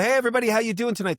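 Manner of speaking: high, quick greeting to a room; comic's stage greeting. Retold or imitated. Comic opening to a crowd, breezy stage greeting. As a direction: toss it high over their heads. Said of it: "They're looking up above everybody".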